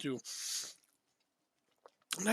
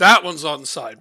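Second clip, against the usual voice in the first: excited; 15 dB louder, slower than usual. Real, not acted. Celebrating a goal that stands.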